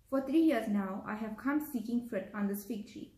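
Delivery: ordinary talk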